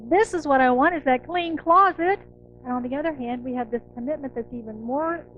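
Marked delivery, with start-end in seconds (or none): 0.1-2.2 mock declaration. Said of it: "we're saying".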